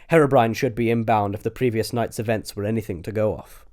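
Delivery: ordinary delivery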